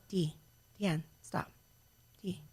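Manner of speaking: low, soft, calm interruption; calm boyfriend. Retold or imitated. Boyfriend stopping her going psycho on her daughter, quiet and low. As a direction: imitate someone reining her in quietly. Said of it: "He'd be like".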